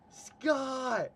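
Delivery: loud, high-pitched holler; retold yell. Storyteller trying to get a distant man's attention, yelling. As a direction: call out so he hears it this time. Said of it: "and I yell".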